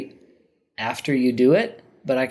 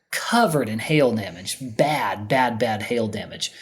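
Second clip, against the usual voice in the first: normal talking voice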